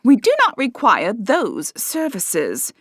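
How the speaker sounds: slow, prim and cold; haughty claim. A narrator's reading. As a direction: look down your nose and say it. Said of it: "Henrietta claimed".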